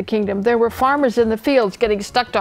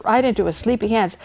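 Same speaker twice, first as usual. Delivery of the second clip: ordinary delivery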